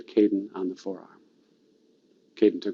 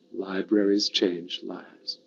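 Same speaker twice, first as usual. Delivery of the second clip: slow, softer repeat of the slogan; leading a recital; 3 semitones lower, 6 dB quieter, slower than usual. Shown as a demonstration. Speaker leads the audience in saying it together, slow and hushed. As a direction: lead a group through it slowly and quietly. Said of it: "Say it with me".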